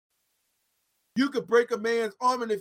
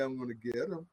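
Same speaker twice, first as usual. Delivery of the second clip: much lower and softer, a hushed divine vow; quiet, low voice of God answering; God's quiet promise; 11 semitones lower, 14 dB quieter than usual. Acted. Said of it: "But he said".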